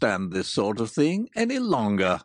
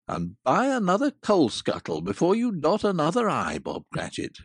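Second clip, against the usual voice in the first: lower, louder, hearty and sincere; earnest old Scrooge; 3 semitones lower, 4 dB louder than usual. Acted. Scrooge wishes Bob a merry Christmas, earnest and hearty. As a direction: pour out real goodwill to your clerk. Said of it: "with an earnestness that could not be mistaken".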